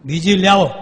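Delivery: mock yell. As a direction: bark for another drum. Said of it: "રાડ પાડી".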